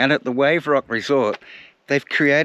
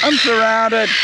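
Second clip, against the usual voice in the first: loud, very high wail; high-pitched alarmed shout; calling out; 12 semitones higher, 6 dB louder, slower than usual. Real, not acted. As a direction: cry out as if mobbed. Calling out, beset by seagulls.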